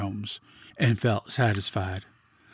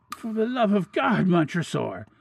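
high, anguished cry for mercy; desperate plea; 10 semitones higher than usual. A narrator's reading. Walled-in victim begs Montresor for mercy, desperately. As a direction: beg for your life, voice pitched high.

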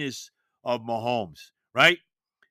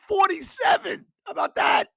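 high, loud triumphant exclaim; gloating cry of victory; excited exclaim; 5 dB louder than usual. Real, not acted. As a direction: crow about being right.

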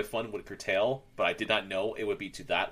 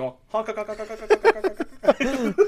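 honking goose; 11 semitones higher, 5 dB louder than usual. Retold or imitated. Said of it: "I'm like".

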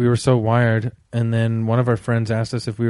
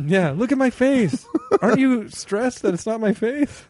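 higher, hammed-up crazy voice; crazy guy voice; 9 semitones higher than usual. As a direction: ham up a wide-eyed weirdo. Acted bit as crazed person.

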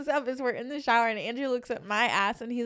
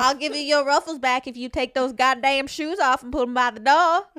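put-on southern accent; 4 semitones higher, 8 dB louder than usual. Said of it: "a southern accent".

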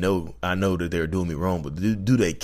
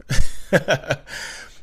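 loud breathy laugh; 10 semitones higher, 6 dB louder than usual. Real, not acted. Laughing at hitting a nerve.

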